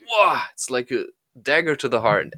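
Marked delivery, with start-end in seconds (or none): 0.1-0.4 shocked gasp. Retold or imitated. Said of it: "your inner child is like".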